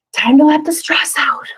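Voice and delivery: high, airy whispered cue; playful parent cue. Pretend. Parent cueing a stressed child to relax, high airy whisper. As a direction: coax the child with a breathy singsong. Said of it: "you might say something like".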